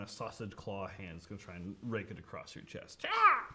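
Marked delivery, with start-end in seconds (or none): 3.1-3.5 high, loud screech; creature attack cry. Acted. Rat King raking claws across the chest, high loud screech. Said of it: "These sound effects are great".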